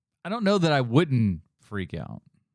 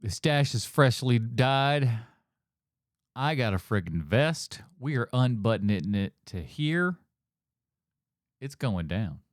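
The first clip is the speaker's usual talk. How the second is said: mock stoked swagger; 3 semitones lower than usual. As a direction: play him smugly pleased, pitched down with long pauses. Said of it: "He was so stoked. He's like".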